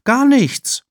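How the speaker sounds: put-on high, loud denial from a girl; shrill-pitched loud "nothing at all"; Emma calling out. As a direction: insist loudly, caught in the act. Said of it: "ruft Emma".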